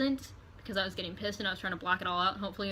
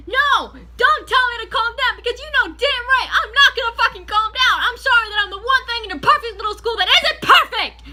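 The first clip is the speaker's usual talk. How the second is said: shrieking, much higher and louder, near tears; screaming at the principal; 13 semitones higher, 13 dB louder than usual. Retold or imitated. Student screaming at her principal, high and tearful. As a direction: scream it with a breaking, crying edge. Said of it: "And I'm like".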